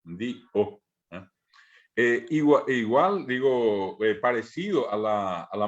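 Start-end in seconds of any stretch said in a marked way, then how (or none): 0.0-0.8 "dió" split into di and a separate ó; pausa glotal. Shown as a demonstration. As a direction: break the voice in the middle of "dió". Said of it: "separamos la voz". («dió» cortado entre la i y la ó)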